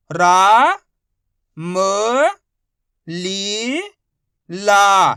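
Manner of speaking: रा, म, ली, ला held long, each separate; drawn-out syllable drill on रा, म, ली, ला; sounding out syllables. Shown as a demonstration. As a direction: call out रा, म, ली, ला with pauses, loud on रा and ला. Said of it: "direct मात्रा का उच्चारण करके पढ़ेंगे".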